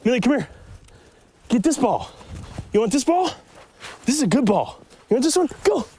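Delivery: rising coaxing questions; playful dog talk. Real, not acted. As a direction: tease the dog with the ball. Teasing the dog with ball.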